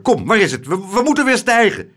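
loud, stammering urgency climbing high; frantic. A narrator's reading. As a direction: push in, flustered and panicky. Panicked man reaches for button.

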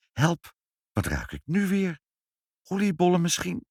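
a hint of fright at a new smell; alarmed mother.

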